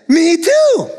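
gleeful me too; excited me too. Acted. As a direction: brighten with glee at the match. Joke, excited agreement.

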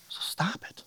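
whispered, earnest urging; hushed plea. Real, not acted. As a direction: drop to an urgent whisper. Urging listeners to drop guilt.

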